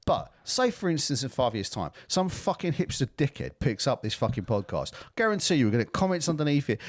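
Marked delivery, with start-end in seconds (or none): none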